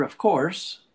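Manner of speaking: ordinary talk, nothing marked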